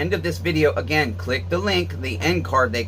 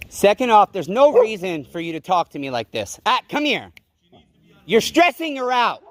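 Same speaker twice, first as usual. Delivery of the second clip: raised, high-pitched objection; real heated pushback at someone; agitated protest; 5 semitones higher than usual. Real, not acted. Dog owner telling officer she's stressing her out, agitated.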